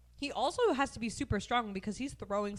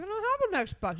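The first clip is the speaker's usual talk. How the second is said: put-on whiny kid voice; 11 semitones higher than usual. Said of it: "He's like".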